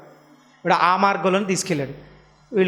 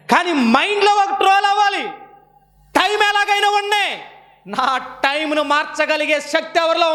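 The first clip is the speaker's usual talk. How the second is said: fervent preaching; 9 semitones higher than usual. Acted. Preaching climax.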